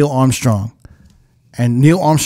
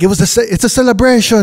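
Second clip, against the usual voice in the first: quick, higher imitated Jamaican accent; 7 semitones higher, faster than usual. Retelling a Jersey DJ's line, mock Jamaican accent. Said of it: "like a Jamaican accent".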